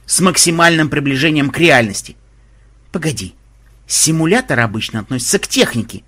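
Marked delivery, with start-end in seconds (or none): none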